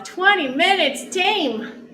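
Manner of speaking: drawn-out high triumphant call; excited callout. Acted. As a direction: announce the finish with pride. End of workout callout.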